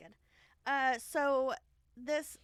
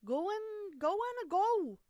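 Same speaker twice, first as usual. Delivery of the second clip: high, louder hammed-up voice; mock indignant cook; 10 semitones higher, 6 dB louder than usual. Co-host joining in as the cook, mock indignant. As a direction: shoo him away, overdoing it.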